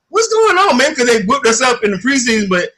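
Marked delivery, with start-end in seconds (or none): 0.1-0.9 much higher puzzled question; mock confusion. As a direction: act baffled at the cheering. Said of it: "I'm like".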